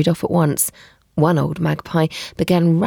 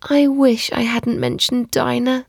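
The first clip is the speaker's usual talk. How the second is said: higher-pitched wistful regret; melancholy; 7 semitones higher than usual. A narrator's reading. Alice left alone, talking to herself, melancholy. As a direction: lament it to yourself, pitched up. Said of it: "in a melancholy tone".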